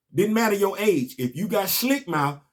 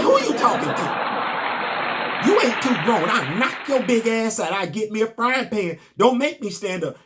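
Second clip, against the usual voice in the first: higher, mock-stern grandma telling him off; scolding grandmother; 4 semitones higher than usual. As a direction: play grandma putting her grown grandson in his place. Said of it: "She said".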